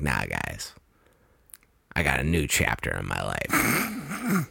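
deep, low tough-guy line; cool action hero. Retold or imitated. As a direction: drop it low like a movie badass. Hero's cool line in retold movie.

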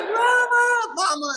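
high mock cries of mama; little sister calling for mom, mock scream; imitating screaming sister. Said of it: "she's like".

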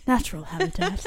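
hushed wildlife narrator voice; nature documentary. Pretend.

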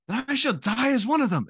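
mild mock yell, imitating a defiant line; quoted movie hero. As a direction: recite it like a remembered heroic movie quote. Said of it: "when Legolas is yelling at him".

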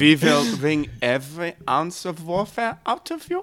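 mock German villain accent; heavy German accent, put on. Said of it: "Russian accent".

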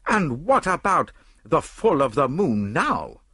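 high, slow, emphatic challenge; worked-up, high-pitched demand; indignant cry. A narrator's reading. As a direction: cry out in agitation, stretching the last words. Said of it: "I cried, springing up".

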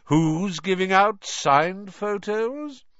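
grand, slow, high-pitched inquiry; pompous celebrity, drawn out; Gilderoy Lockhart. A narrator's reading. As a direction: ask it loudly and theatrically.

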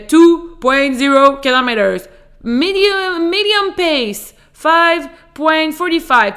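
loud, high, slow robotic app announcer; running app voice. Retold or imitated. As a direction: call out stats like a fitness tracker. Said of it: "me faire crier dans l'oreille".

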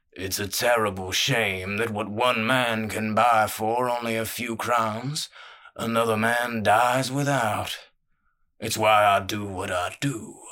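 big man's slow, self-satisfied voice; genial menacing boss. Acted. Crime boss explaining his trade to the thief, genial menace. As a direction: sound generous while the threat hangs.

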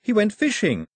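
put-on a boy protesting the ending; indignant boy. A narrator's reading.

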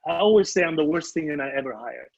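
ordinary talk

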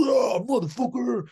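a high, loud hammed-up roar; mock roar. At the dinner table, a hammed-up mock roar. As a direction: bark it out like a brute at the table. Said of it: "sit at the dinner table and be like".

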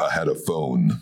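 normal talking voice

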